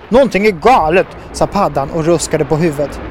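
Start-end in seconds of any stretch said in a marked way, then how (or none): 0.1-1.1 lightly voiced toad, louder and higher; a hint of a toad sounding the alarm; worried toad. A narrator's reading. Toad warning the crew that something is wrong, lightly voiced alarm.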